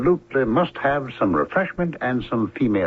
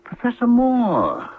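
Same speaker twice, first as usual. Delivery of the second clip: surprised; 14 semitones higher, slower than usual. Acted. Stranger hears his old boss named.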